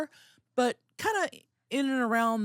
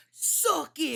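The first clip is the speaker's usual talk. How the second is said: Suck it catchphrase; 8 semitones higher than usual. Retold or imitated. Recalling a childhood wrestling taunt, high mock yell. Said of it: "their version of".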